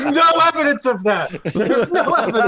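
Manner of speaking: high, laughing denial; laughing protest. Real, not acted. Player denying the accusation, laughing. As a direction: deny it while cracking up.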